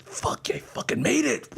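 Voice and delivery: high, slow whisper of relief; relieved whisper. Retold or imitated. Sneaking home hungover, whispered relief. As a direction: whisper it like a secret win. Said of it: "I was like".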